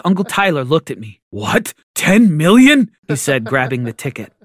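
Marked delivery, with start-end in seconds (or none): 1.3-2.9 incredulous. A narrator's reading. Uncle grabbing the winning ticket, incredulous. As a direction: repeat it in disbelief.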